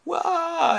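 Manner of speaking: high-pitched comic wah; imitated dog wail. Old dog recognizing master, high mock wail.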